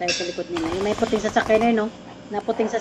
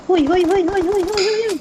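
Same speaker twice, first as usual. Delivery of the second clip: alarmed shouting; 9 semitones higher, 5 dB louder than usual. Real, not acted. Alarmed calling out in combat.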